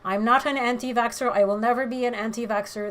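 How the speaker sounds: normal talking voice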